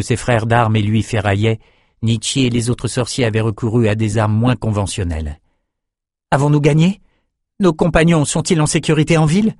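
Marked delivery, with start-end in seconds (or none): 6.3-9.6 higher-pitched urgent questions; anxious young hero. Acted. Bannon asking about companions in town, concerned. Worried captive asks after his friends.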